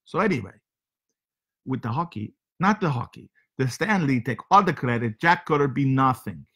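comic foreign host with broken English; heavy comic accent, broken grammar. Acted. Comic foreign character Sergey hosting show.

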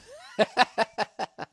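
high, loud burst of laughter; quick, pealing laugh. Real, not acted. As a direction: cackle at your own joke. Laughs at his own joke.

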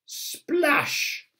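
put-on high, bright "Splash!" for the kids; splash sound effect. A narrator's reading. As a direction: make the splash big and playful. Narrator voices the pond splash.

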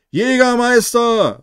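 triumphant party crowd calling out; chorus shout. A narrator's reading. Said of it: "rufen mehrere im Chor".

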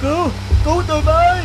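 high, loud desperate cry for help; calling for help. Acted. Stranded traveller shouting to passing car for help. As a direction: shout out for someone to save you.

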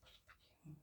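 faint whisper under the breath; barely audible whisper; whispered invocation. Real, not acted. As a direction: breathe it out as a tiny prayer. Sighs a prayer to herself.

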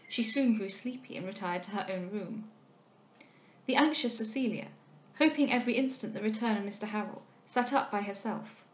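ordinary delivery

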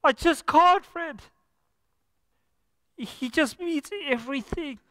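real tears breaking through the words; choked up. Real, not acted. Preacher moved to tears telling congregation, choked up. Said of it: "I can't sing about the Lord without crying".

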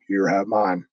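a hint of a low-voiced impression; Undertaker. Undertaker offering his beer, lightly imitated low voice. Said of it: "Undertaker's like".

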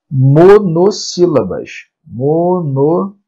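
"monossílaba" drawn out slowly in separate chunks; slow, segmented teaching of the term; syllable by syllable. Shown as a demonstration. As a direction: stretch and space each syllable of "monossílaba".

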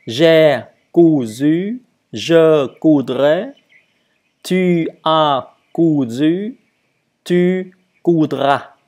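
loud, slow teacher's drill; emphatic model for learners to copy; model conjugation. Shown as a demonstration. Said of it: "pareil ici".

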